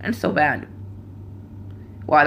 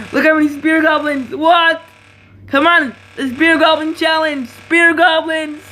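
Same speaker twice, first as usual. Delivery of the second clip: excited shout; 8 semitones higher than usual. Real, not acted. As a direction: shout at the swarm on screen. Enemy floods spear goblins.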